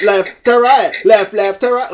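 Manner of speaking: high, loud mock Patois hook; fake Patois accent. Podcaster mocking the song's hook, fake Patois accent. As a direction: chant it in exaggerated Patois. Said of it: "fake Patois accent".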